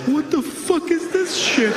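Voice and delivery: very high, slow baffled voice; high, slow, baffled lion voice; bewildered lion. Retold or imitated. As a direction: make the lion squeak in total confusion. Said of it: "the lion's like".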